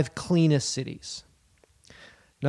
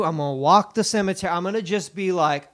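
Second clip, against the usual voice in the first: ordinary delivery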